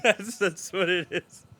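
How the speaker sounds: breaking up laughing; words through helpless laughter. Real, not acted. Laughing fit at joke.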